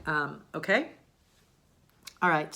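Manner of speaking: ordinary delivery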